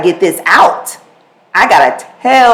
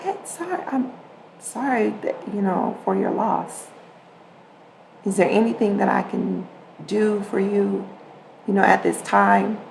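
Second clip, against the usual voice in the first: much softer, gentle and caring; empathetic; 10 dB quieter than usual. Shown as a demonstration. Comforting a grieving coworker in a soft, caring voice. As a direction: soothe someone who just lost a loved one. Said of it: "There is empathy in the tone right there".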